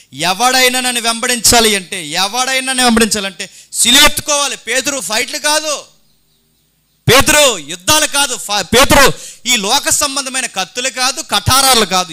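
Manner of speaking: loud, high preaching cry, building; fiery preaching. Acted. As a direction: hammer the point home to the congregation. Preaching at full force. (సభకు బిగ్గరగా ఉద్వేగభరితమైన ప్రసంగం)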